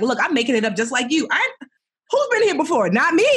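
high, loud and breezy, rising to a squeak; playful confession. Retold or imitated. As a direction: laugh off not knowing anything. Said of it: "And I'm always like".

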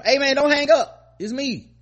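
high, loud begging voice; pleading inmate. Retold or imitated. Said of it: "collect call from".

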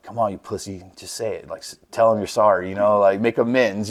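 under his breath, goading himself to say it; muttered self-pep talk. Retold or imitated. Riding to the plant, egging himself on under his breath. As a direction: quietly push yourself to apologize.

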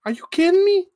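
high, incredulous squeak; outraged disbelief in a high voice; shocked high character voice; indignant Cassie. Acted.

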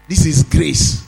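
emphatic preacher, stretching key words; forceful preaching. Real, not acted. Preaches after quieter anecdote.

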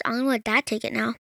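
ordinary delivery

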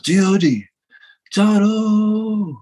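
high drawn-out announcer call; ring announcer call. Retold or imitated. Announcer introducing the wrestler, high drawn-out call. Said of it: "And he goes".

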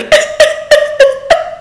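imitating a high sleepy giggle; squeaky breathless laugh; a very high, airy laugh. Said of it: "and I was like".

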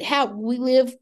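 ordinary talk, nothing marked

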